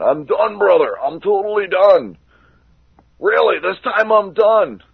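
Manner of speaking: hammed-up Hogan, pitched high; mocking Hulk Hogan.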